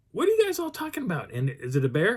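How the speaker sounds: very high, incredulous question; baffled questioning. Retold or imitated. Hiker to the fleeing group, high-pitched bafflement. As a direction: demand an explanation in a high voice.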